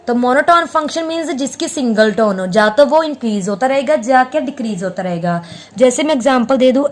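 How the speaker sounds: ordinary delivery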